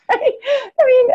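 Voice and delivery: very high, laughing voice near tears; real laugh on the edge of crying. Real, not acted. Laughing and teary over story.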